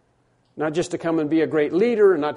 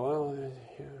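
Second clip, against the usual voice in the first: noncommittal; 11 dB quieter than usual. Retold or imitated. Acts unconvinced hearer of the gospel.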